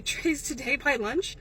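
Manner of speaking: normal talking voice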